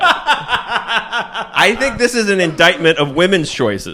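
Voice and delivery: high, loud, laughing sermon; laughing mock lecture. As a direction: preach it while cracking up.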